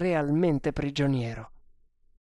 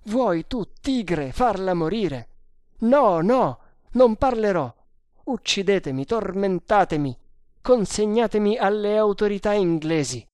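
raised, forceful outburst; desperate defiance; 6 semitones higher, 6 dB louder than usual. Acted. Prisoner refusing to betray his beloved, desperate defiance.

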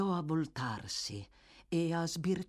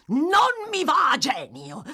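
furious shout; 12 semitones higher, 8 dB louder than usual. A narrator's reading.

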